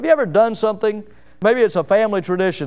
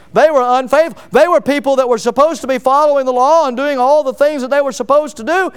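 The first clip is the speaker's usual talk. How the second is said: higher, forceful sermon delivery; impassioned preaching; 5 semitones higher, 4 dB louder than usual. Acted. Preacher argues to congregation about false believers, impassioned preaching. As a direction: drive the argument in one rising push. Preacher building a heated argument.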